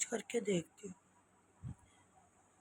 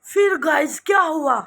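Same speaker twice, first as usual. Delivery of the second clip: excited build-up; 6 semitones higher, 10 dB louder than usual. Real, not acted. Building suspense for viewers.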